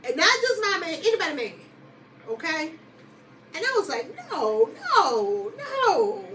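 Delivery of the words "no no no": high, rising series of no's; reenacted incredulous refusal, pitched up; disbelieving denial. Retelling her reply to the women, disbelieving denial.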